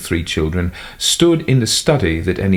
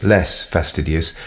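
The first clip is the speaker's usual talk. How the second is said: usual speaking voice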